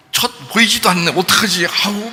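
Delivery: high, playful fretting; mock frustrated searching. Adult pretending the hidden child is invisible, mock frustration. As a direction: pretend to be stumped and fretful. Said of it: "막 안타까워하고".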